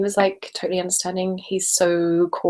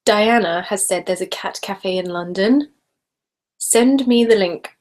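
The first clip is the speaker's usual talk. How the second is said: ordinary talk, nothing marked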